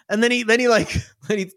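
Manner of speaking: usual speaking voice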